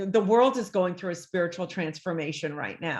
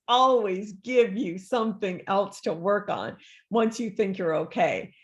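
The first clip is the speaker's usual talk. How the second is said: ordinary talk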